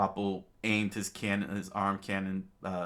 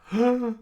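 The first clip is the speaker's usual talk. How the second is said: sharp high loud gasp; king gasping at the surviving flag; shocked gasp; 10 dB louder than usual. Acted. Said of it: "And Wapol was shocked".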